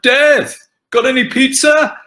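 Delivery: high, loud call across the street; yelled out. Retold or imitated. A neighbour yelling out a cheeky greeting to the actor. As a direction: shout it at him like a fan down the road. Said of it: "somebody will yell out".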